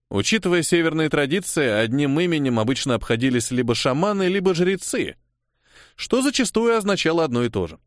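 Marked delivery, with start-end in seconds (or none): none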